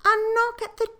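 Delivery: very high, slow surprised queen. Acted. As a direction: lift way up into a startled queen.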